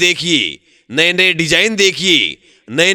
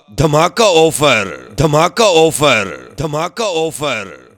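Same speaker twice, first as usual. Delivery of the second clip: slow drawn-out slogan; 3 semitones lower, much slower than usual. Acted.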